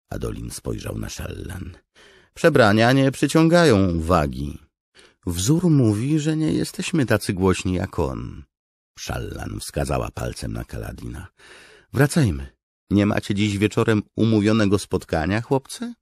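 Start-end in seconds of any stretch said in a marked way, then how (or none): 2.3-8.4 much higher, louder character voice; Shallan in dialogue. A narrator's reading. 11.9-15.9 high-pitched, raised character voice; Shallan in dialogue. A narrator's reading.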